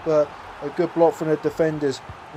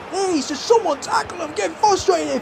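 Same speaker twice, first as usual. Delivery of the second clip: much higher, pleading cry at his defenders; desperate plea; 11 semitones higher than usual. Real, not acted. Begging his defenders to stop the attacker, high desperate plea. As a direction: beg someone to just make the tackle.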